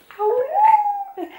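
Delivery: a high, loud howl like a little wolf; mock baby howl. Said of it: "he was like".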